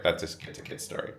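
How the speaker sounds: fast whispered repeat. Real, not acted. Repeating the tampon joke to camera, whispered. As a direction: whisper the punchline again, quick.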